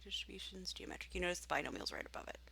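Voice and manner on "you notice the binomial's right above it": quick hushed side note; whispered aside. Real, not acted. Teacher pointing out a menu item, fast whisper. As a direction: whisper it fast like a secret tip.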